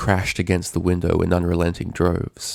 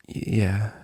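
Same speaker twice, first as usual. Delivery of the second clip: shaken stammer; 2 semitones higher, 4 dB quieter than usual. A narrator's reading. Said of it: "kept her eyes locked on the book".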